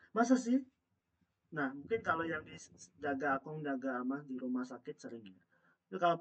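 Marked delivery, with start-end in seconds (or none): 0.1-0.6 surprised. Real, not acted. Teacher doubts students' answer.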